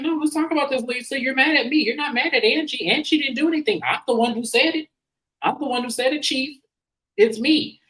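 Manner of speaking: mock-insistent, owning up; Bronwyn confronting. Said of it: "And Bronwyn's like".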